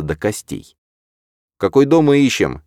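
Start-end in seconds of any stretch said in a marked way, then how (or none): 1.6-2.6 higher, louder, losing patience; impatient question. A narrator's reading. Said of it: "не выдержал я".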